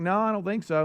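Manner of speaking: high mock wife voice.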